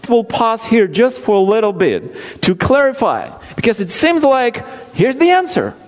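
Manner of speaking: ordinary talk, nothing marked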